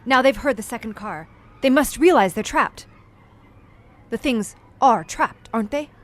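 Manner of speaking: loud, urgent and keyed up; anxious excitement. Acted. Visitor following the hunt over the speaker, loud anxious excitement. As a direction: press him for an answer, caught up in the chase.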